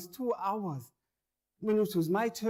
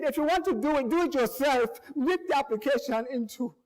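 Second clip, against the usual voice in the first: angry clerk; 5 semitones higher, 3 dB louder than usual. Retold or imitated. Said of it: "She said".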